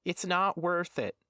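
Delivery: high, slow odd character voice; crooked-necked man. A narrator's reading. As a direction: dissuade him in a high, slow voice. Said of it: "said the man with the crooked neck".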